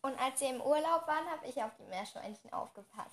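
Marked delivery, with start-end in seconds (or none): 1.3-3.1 softly, trailing off into a murmur; too quiet. Real, not acted. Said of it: "du musst lauter sprechen".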